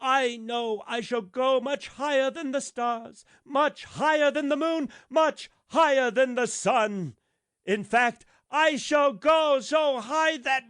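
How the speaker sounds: high, loud, swelling grander line by line; rising boast. A narrator's reading. The rocket boasting as he climbs, loud and soaring.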